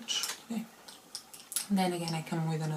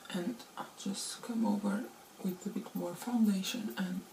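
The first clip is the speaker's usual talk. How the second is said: whispering; 2 semitones higher, 4 dB quieter than usual. Real, not acted. Quiet aside while applying.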